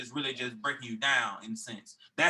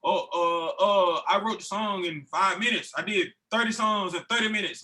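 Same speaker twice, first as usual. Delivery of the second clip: higher, louder mocking impression; put-on braggart, pitched up; mock boasting; 6 semitones higher, 4 dB louder than usual. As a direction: imitate people bragging about quick songwriting. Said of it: "I hate when people be like".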